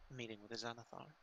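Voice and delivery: hushed. Acted. DM voices Quietude, hushed.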